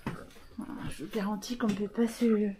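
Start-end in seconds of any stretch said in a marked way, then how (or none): none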